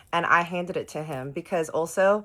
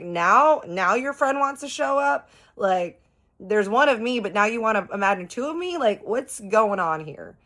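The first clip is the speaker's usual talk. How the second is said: exasperated rant; 5 semitones higher, 3 dB louder than usual. Retold or imitated. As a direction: scold him in disbelief. Exasperated rebuke of Harley.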